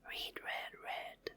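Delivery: whispered repeat, no voice. Shown as a demonstration. Teacher whispering the words back for kids. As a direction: breathe "Read, read, read" softly.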